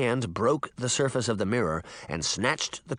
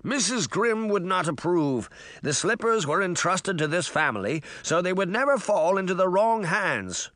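higher, prim servant voice refusing; Mirror, stern refusal; 6 semitones higher than usual. A narrator's reading. Mirror refusing Jack the slippers, prim and firm. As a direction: hold the line like a proud butler.

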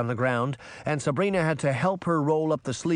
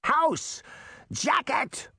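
high, sharp calls to the hut; cried commands; 14 semitones higher, much slower than usual. A narrator's reading. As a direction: order the house about like a witch. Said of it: "Baba Yaga cried".

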